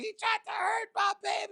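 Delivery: high, wailing mother's cry; imitating his upset mother. Son imitating his mother comforting him, high wailing mother voice. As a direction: mimic a mom fussing over her hurt son. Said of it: "She was like".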